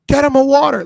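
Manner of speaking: loud, high mock order; mock Adam Pearce shout. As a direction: imitate an official shouting an urgent order. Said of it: "Adam Pearce is like".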